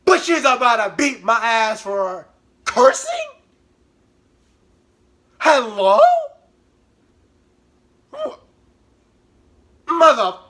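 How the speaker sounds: loud, high exasperated outburst; ranting yell. Real, not acted. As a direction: shout in disbelief. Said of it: "ranting about cursing".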